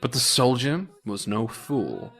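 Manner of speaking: ordinary delivery, nothing marked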